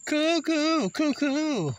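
high, hooting cuckoo-clock imitation; playful high cuckoo; sing-song cuckoo call. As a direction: tease with a high two-note cuckoo. Teasing dog as crazy.

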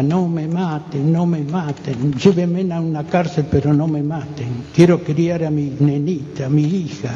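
ordinary talk, nothing marked

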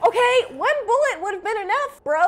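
high, loud exclamation; outraged protest. Real, not acted. Viewer protesting to the shooter, loud high outrage. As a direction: object loudly, pitched well up.

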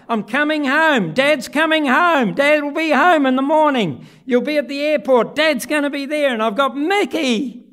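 bursting with joy down the phone; excited phone call. Retold or imitated. Father phoning his little boy from the plane, excited and loud. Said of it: "I ring up".

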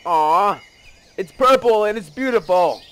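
loud, high-pitched adoration; high, emphatic delight; gushing. Real, not acted. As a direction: coo loudly over how pretty it is. Gushes over a fruit tree.

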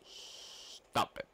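stern whisper. Acted. Stern command, whispered slow.

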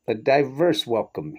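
slow, careful enunciation; slow precise welcome with a light British tinge; enunciated carefully. Acted. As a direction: pronounce each word deliberately. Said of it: "he enunciated carefully".

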